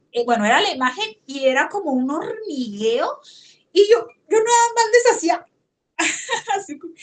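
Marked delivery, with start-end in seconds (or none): 3.7-6.9 high, breaking laugh on the words; overwhelmed laugh spilling in; laughing through emotion. Real, not acted. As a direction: giggle caught between tears and joy.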